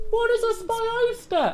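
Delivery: mock audience question. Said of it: "I hear you all ask".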